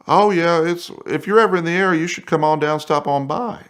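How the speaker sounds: high, loud, eager mock voice, quick at first; mimicking June's invite. June inviting him to come on down, high and loud. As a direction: pitch it way up like a chirpy Southern lady.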